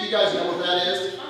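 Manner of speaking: usual speaking voice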